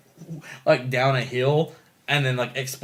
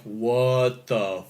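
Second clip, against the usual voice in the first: slow, drawn-out bafflement; baffled disbelief; 3 semitones lower, much slower than usual. Retold or imitated. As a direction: stretch out each word in disbelief. Retold viewer's baffled reaction.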